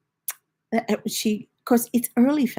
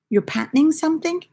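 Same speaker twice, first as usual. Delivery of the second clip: higher, louder retold question; puzzled son; 8 semitones higher, 5 dB louder than usual. Son questions his mum's odd word, retold puzzlement. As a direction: imitate a baffled listener checking what was meant. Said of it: "And he's like".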